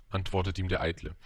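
ordinary delivery, nothing marked

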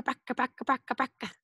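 rattling "bracka" engine noise; old car rattle. Shown as a demonstration. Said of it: "Can you say bracka".

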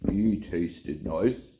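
very slow, content hippo; slow satisfied hippo. Acted. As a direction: savour it like a fat hippo. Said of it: "said the hippo".

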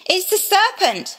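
high, protesting cry; Eve crying out. Acted. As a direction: pass the blame in a high cry. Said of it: "she cried".

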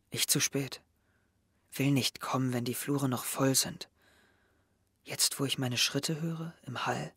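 soft, hushed diary reading; reading a diary aloud. Acted. Henry reads Mira's secret diary entry to himself, softly. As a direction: read it like you're sinking into her words.

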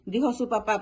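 plain reading voice

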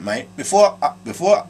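ordinary talk, nothing marked

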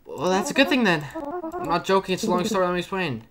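high-pitched explaining voice; game character. Acted. Game character explaining a long story, high voice.

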